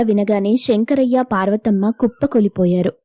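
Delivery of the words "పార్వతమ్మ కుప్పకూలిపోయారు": ordinary delivery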